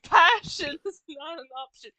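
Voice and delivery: booming mock decree; loud mock pronouncement. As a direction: boom it like a decree. Loud mocking line.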